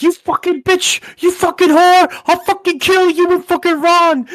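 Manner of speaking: high, loud enraged scream at the tapes; furious screaming quote. Retold or imitated. O J on the tapes, screaming threats in fury. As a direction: scream it like a man losing his temper. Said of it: "And he's like".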